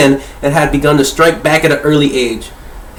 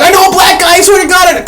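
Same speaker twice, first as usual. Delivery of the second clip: much higher, louder, frantic pleading; mock panicked excuse; 13 semitones higher, 3 dB louder than usual. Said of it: "I was like".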